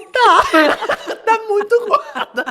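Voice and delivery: high, loud answer through laughter; blunt laughing reply. Retold or imitated. Mother retelling her son's blunt answer, high and laughing. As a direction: blurt it out loudly, laughing.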